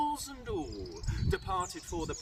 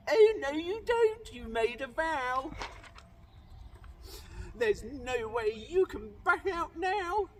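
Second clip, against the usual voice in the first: insistent; 6 semitones higher, 4 dB louder than usual. Acted. Sister refuses to release the prince.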